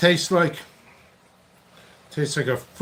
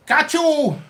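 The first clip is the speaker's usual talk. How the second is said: put-on high, punched Korean-accent shout; sudden high exclamation in a put-on Korean accent; 12 semitones higher, 4 dB louder than usual.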